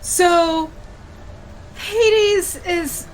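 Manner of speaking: ordinary talk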